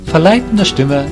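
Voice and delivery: usual speaking voice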